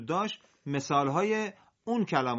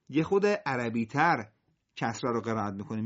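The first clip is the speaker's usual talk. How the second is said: normal talking voice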